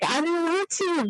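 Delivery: high, mock-whiny refusal; reluctant protest. Answering the inner voice urging her on, reluctant protest.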